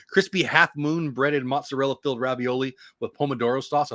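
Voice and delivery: ordinary talk, nothing marked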